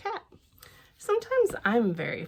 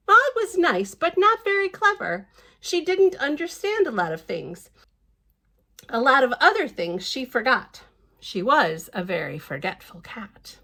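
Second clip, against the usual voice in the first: higher, louder emphatic reread; reading to children; 4 semitones higher, 7 dB louder than usual. Acted. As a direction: read it again with big storybook emphasis.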